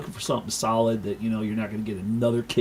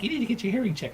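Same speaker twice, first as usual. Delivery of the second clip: higher, mock-nagging wife voice; imitating the wife; 11 semitones higher, faster than usual. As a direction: ape the wife's complaint, pitched up. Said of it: "And she's like".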